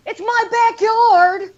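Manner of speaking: high, slow mock yell; yelled back. Yelling back at the neighbor over the fence, high and slow. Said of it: "so I yelled back at her".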